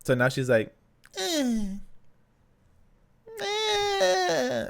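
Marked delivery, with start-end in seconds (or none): 1.2-4.7 high thin whimpering "eh"; light fussy baby cry. Retold or imitated. New mother showing how her baby cries now, whimpering. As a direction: mimic a newborn's fretful little cry. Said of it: "very light, very annoying type of, like, cry".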